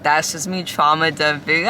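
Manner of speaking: usual speaking voice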